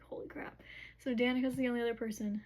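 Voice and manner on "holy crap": whispered, amazed reaction, acted out; hushed awe. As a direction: drop to a near-whisper of wonder. Retold awed reaction.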